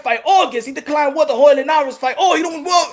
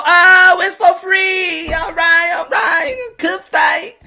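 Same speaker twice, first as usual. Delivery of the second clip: higher, louder delighted cry; mock excitement; 6 semitones higher, 4 dB louder, much slower than usual. A fan thrilled the fight is free, mock excitement. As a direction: gush over the free fight.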